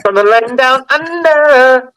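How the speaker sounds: high, slow drawn-out announcer call; announcer hype. Acted. Host introducing the Australian guest, drawn-out hype call. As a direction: boom it out like a ring announcer.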